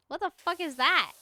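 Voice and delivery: loud, high disbelief; loud surprise. Real, not acted. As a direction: blurt it out in shock. Hears of an unknown game.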